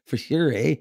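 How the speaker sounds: a light Canadian "eh" drawn upward; Canadian accent. Retold or imitated. Host mimics a Canadian partier, Canadian accent. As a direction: play a Canadian student, loud and pleased. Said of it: "in a Canadian accent".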